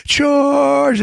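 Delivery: high, drawn-out call to charge; battle cry. Retold or imitated. Host re-enacting the Scots' attack, high rallying cry. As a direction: cry it out like leading troops.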